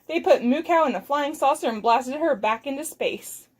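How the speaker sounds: ordinary delivery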